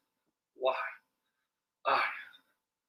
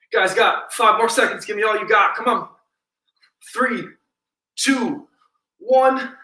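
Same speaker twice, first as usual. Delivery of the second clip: pumped-up countdown; 5 semitones higher, 3 dB louder than usual. Real, not acted. Coach pushes class to finish.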